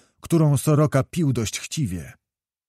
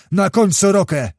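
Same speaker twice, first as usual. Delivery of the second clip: high, raised word of command; 6 semitones higher, 4 dB louder than usual. A narrator's reading. Kmicic orders Soroka put on a horse, commanding high. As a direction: give an urgent mounted order. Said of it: "zakomenderował znowu Kmicic".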